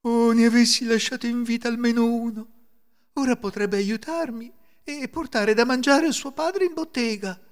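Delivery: high-pitched, wailing regret; tearful lament. A narrator's reading. Woman regretting the children she killed, tearful lament. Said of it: "si mise a piangere".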